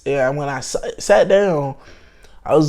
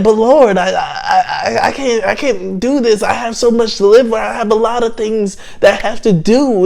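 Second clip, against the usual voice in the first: higher-pitched, stammering mock plea; urgent retold bargaining, higher voice; retold pleading; 7 semitones higher, 3 dB louder than usual. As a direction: act out begging for your life, pitched up. Acted plea to God to return.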